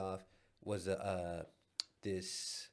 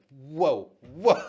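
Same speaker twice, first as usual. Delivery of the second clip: startled whoa; 3 semitones higher, 12 dB louder than usual. Retold or imitated. Startled at the door.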